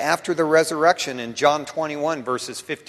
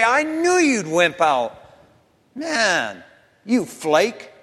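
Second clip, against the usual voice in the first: loud, higher-pitched acted scorn; mock scornful rebuke; 7 semitones higher, 4 dB louder, slower than usual. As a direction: play a disappointed put-down. Imagined scornful rebuke from Jesus.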